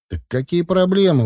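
puzzled, pitch lifting on the question; surprised. A narrator's reading. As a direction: ask with light, breezy surprise. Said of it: "удивился я".

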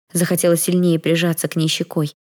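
ordinary delivery

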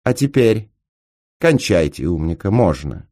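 plain reading voice

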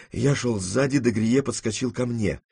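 usual speaking voice

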